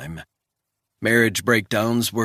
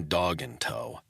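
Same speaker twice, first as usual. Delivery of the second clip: usual speaking voice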